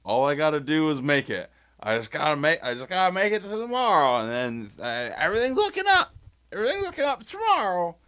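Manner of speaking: high, rushed and increasingly frantic; a manic parody of hanging on till tomorrow; mock desperate optimist. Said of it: "It's saying".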